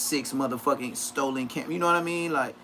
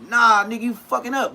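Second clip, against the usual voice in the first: retold scolding; 6 semitones higher, 6 dB louder than usual. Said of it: "He was like".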